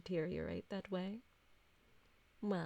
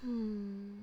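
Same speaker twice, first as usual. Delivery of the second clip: savouring hum; 2 semitones higher, 3 dB louder than usual. Acted. Vampire savours the blood.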